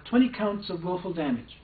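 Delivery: ordinary delivery, nothing marked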